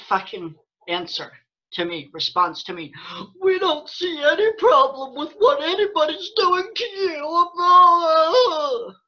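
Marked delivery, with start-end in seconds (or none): 3.4-8.9 high, slow, whiny mocking voice; mock YouTube reply. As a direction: sneer their answer back in a sobbing whine. Mocking YouTube support's answer.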